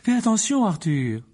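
high-pitched wifely warning. A narrator's reading. Mrs Weasley cautions her husband about Malfoy, warning. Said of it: "avertit Madame Weasley".

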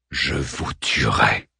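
slow, low, coldly composed; very calm. A narrator's reading. Arman calmly threatening Ariel, slow and composed. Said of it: "sur un ton des plus posés".

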